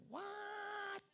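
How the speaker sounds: sudden high squeaky gasp of a word; shrill disbelief, parent voice; shocked what. Acted. Parents hearing Mary's news, shocked high squeak.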